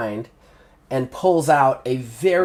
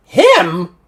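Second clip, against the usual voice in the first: louder, higher startled echo; surprised Kelly; 4 semitones higher, 6 dB louder than usual. Acted. Kelly startled the thugs want her companion, surprised. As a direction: she can't believe it's him. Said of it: "Kelly's like".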